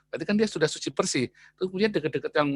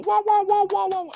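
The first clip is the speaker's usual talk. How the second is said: high-pitched mock chatter of a complaining wife; babbling wife. Preacher mimics the first wife complaining to him, in high-pitched mock chatter. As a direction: rattle off wah wah like a gossiping wife.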